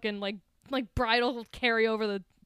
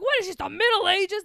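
high, loud indignant imitation; mock outrage; 8 semitones higher, 7 dB louder than usual. Outraged friend scolding hospital staff, mock indignant shout. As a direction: protest loudly like an appalled New Yorker.

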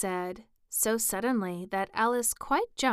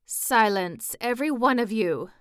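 louder sharp command for silence; tiger lily shouting; 3 semitones higher, 6 dB louder than usual. Acted. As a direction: silence the daisies with a loud shout. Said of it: "cried the tiger lily".

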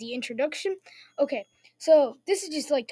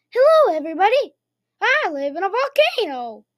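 put-on loud, high five-year-old character voice; little boy Jimmy; 5 semitones higher, 8 dB louder than usual. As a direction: put on an exaggerated little-kid accent. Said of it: "he said that in that exact accent".

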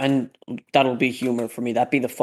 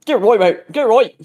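1 mock British; 8 semitones higher, 6 dB louder than usual.

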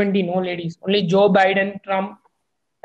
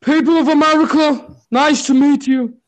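higher-pitched, hammed-up politician's greeting; Obama; 4 semitones higher, 3 dB louder than usual. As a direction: greet the nation in a badly faked statesman's voice. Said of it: "That was a terrible in-, impression".

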